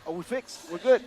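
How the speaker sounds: excited. Real, not acted. Relief after the tech fix.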